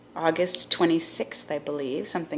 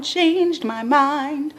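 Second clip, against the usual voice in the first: higher, slow, drawn-out theatrical reveal; mock dramatic reveal; 9 semitones higher, much slower than usual. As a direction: stretch it out like a big plot twist. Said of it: "dun, dun, dun".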